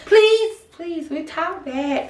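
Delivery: put-on high pleading baby voice. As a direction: beg like a little kid. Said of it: "That baby voice got to go".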